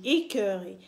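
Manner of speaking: ordinary talk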